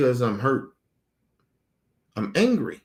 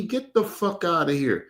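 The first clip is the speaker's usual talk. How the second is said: ordinary talk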